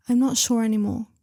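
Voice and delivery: ordinary delivery, nothing marked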